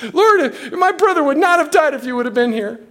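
high-pitched plea, no real sobs; acted tearful lament. Mary comes to Jesus over her dead brother, mock tearful. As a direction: lightly imitate a grieving woman's cry. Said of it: "And she's crying and weeping".

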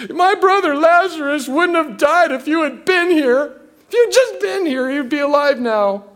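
high-pitched, acted grieving reproach; upset. Martha runs to Jesus after her brother's death, upset. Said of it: "And she was upset".

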